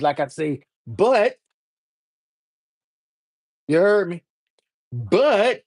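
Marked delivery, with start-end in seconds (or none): 0.9-1.4 BUT with a crisp, doubled final T; over-enunciated T. Shown as a demonstration. Stressing a condition to the chat, BUT with an emphatic double T. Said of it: "but with a couple of T's". 4.9-5.6 BUT pitched up, final T popped hard; over-enunciated T. Shown as a demonstration. Repeating it for the chat, BUT with an emphatic double T. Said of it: "but with a couple of T's".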